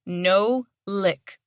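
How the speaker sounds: stern, firm command; stern command to a dog. A narrator's reading. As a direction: scold the dog firmly. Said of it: "voice stern".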